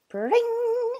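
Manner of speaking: high, ringing sound effect; ping it out, held high; springing spikes. Acted. Echidna putting up spikes, springing sound. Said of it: "put up his spikes".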